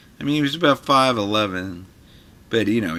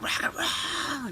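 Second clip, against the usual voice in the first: mock rock and roll; 11 dB quieter, much slower than usual. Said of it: "his whole thing was like".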